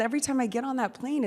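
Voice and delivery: normal talking voice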